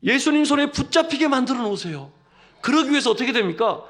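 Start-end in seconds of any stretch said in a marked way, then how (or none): none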